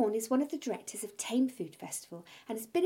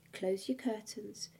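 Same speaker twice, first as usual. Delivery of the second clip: seductive hush; 5 dB quieter than usual. Acted. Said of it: "in a slightly seductive way".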